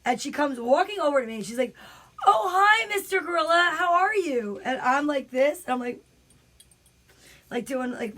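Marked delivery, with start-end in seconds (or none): 2.3-4.6 high, loud gushing greeting; animated friend. Retold or imitated. Friend greeting the mystery gorilla on the street, animated. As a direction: play the over-animated friend greeting a costumed character. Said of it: "she's also very animated".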